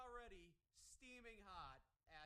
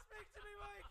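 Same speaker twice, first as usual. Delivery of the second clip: high, loud mock plea; hammed-up desperate cry to a friend; frantic yelling; 10 semitones higher, 6 dB louder than usual. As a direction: shout it in pretend panic.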